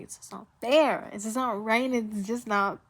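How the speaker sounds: loud, high wailing repeat; upset outcry. Real, not acted. Vlogger upset about a TV character's death, loud wail. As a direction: cry out that it's unfair.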